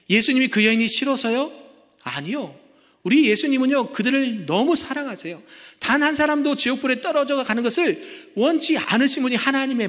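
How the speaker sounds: fervent preaching. Acted. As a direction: preach it with rising fervour. Preacher rises in fervour.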